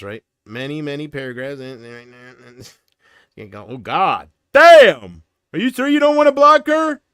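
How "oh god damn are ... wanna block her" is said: loud, rising yell of disbelief; mock exasperated outburst. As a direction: blow up in hammed-up dismay. Mock outburst at long messages.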